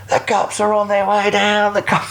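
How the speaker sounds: high shouted warning from above; high mock yell that the cops are coming; retold scream. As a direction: yell it down the hill, mock panic. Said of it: "he's screaming out at me".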